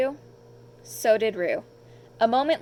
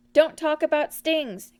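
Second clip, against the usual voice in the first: anxious cry; 5 semitones higher, 4 dB louder than usual. A narrator's reading. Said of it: "cried Piglet".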